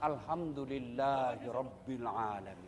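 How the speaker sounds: slow, low, reverent recitation; devout recitation. Shown as a demonstration. Preacher shows the congregation how to give thanks, slow and reverent. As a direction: intone it slowly and low. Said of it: "Jangan kau bilang".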